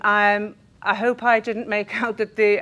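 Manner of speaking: ordinary talk, nothing marked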